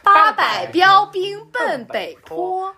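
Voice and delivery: slow, high, crisp announcer delivery; broadcaster tongue twister. Shown as a demonstration. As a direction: enunciate every syllable slowly. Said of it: "八百标兵奔美国".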